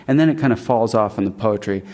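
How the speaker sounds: ordinary talk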